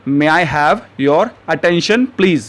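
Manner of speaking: very slow, each word spaced out for learners; slow teaching model. Shown as a demonstration. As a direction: say it slowly and clearly like a teacher modeling a phrase. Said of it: "तो English में आप कहेंगे".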